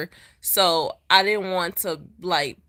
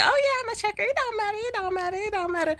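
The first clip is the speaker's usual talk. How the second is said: high, breezy carefree voice, softening; mocking own boast; 13 semitones higher, 4 dB quieter, much faster than usual. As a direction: mimic your careless bragging self. Mocking her own careless boast.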